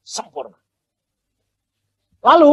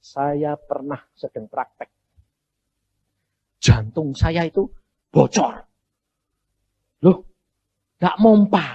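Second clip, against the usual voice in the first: normal talking voice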